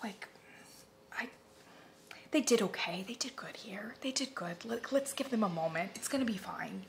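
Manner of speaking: hushed, soft reassuring whisper; calm yourself down gently; soothing whisper. Retold or imitated. Fan whispering reassurance about the new candle company. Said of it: "I'm kind of sympathetic to them".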